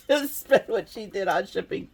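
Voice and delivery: words laughed out; laughing. Real, not acted. Crafter laughing at herself about shipping costs. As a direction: chuckle through the line.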